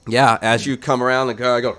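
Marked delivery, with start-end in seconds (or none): none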